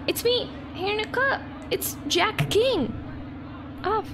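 high, quick little cartoon guest voice; squeaky guest voice. Gamer voices her guest introducing himself, high and squeaky. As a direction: play the tiny park guest greeting.

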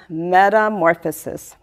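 ordinary delivery

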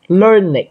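learned in two syllables, LUR-nid; ịch ending. Shown as a demonstration. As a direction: model learned with "ịch" at the end. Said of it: "âm ịch".

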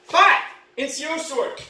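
usual speaking voice

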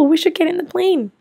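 ordinary talk

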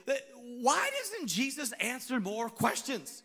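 usual speaking voice

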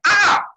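short, high, loud cry; sudden high yelp of outrage; angry shriek. Retold or imitated. Semele lashes out at Zeus.

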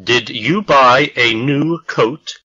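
ordinary delivery

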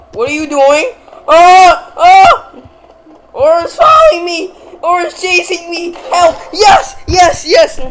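panicked high yelling; being chased. Real, not acted. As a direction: scream for help while fleeing. Aura's chasing me. Help!.

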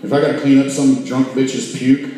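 ordinary talk, nothing marked

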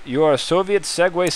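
ordinary delivery

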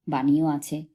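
ordinary delivery

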